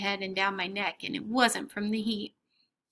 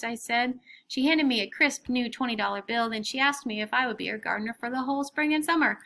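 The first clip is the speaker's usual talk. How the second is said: ordinary delivery, nothing marked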